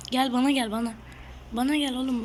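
loud taunting call, come here. Real, not acted. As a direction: bait the enemy loudly. Calling an enemy to come at him.

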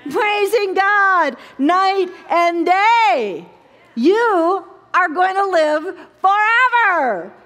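high, loud and slow, shouted praise; full-force preaching. Acted. Preacher shouting praise to the congregation, full-force and slow. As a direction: preach it out to the rafters.

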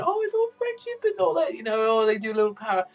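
high-pitched sing-out mimicry; mock-silly voice, far above normal pitch; mocking the haters. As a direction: parody a naive critic in a squeaky high voice. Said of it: "like, you thinking".

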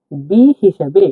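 usual speaking voice

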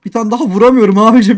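loud, exasperated complaint; frustrated. Real, not acted. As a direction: vent at missing the hit. Frustrated gamer can't land hits.

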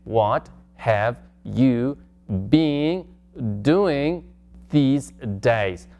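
extremely slow, stretched words. Shown as a demonstration. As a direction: crawl through each word for the student. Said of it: "Más despacito".

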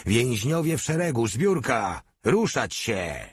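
narrator's restrained parade-ground roar; roared order. A narrator's reading. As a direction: bark orders at prisoners. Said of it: "Następnie ryknął tak, że echo odbiło się".

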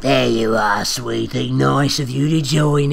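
slow, lightly raspy villain croak. Acted. Reader voicing the kidnapper greeting the girl, slow and raspy. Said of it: "his raspy voice croaked".

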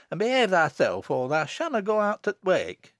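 loud, high country threat; angry scolding. Acted. Mother rapping child's knuckles, loud scolding. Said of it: "cried Elizabeth".

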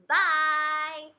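loud, high, long-held "Bye"; calling goodbye. Pretend. Parent sees child off, loud drawn-out call.

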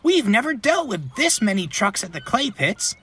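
high-pitched huffy little engine; defensive protest. A narrator's reading. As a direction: make excuses in a high, put-out voice. Ben protests blame, is cut off.

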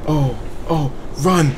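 usual speaking voice

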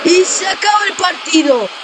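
high shouted victory call; excited goal call. Acted. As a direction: roar the winning goal and the final whistle. Winning hat-trick goal, final whistle.

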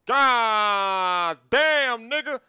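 ordinary delivery

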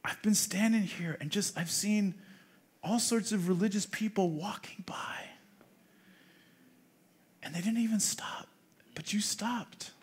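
soft, near-whispered quote; hushed, retold gratitude. Pastor voicing the woman nobody stopped for, hushed. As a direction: let it sink toward a whisper by the end.

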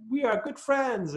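put-on high, pleased child's voice; a boy glad to make a friend; mild childish delight; happy little boy. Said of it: "he was very happy about that".

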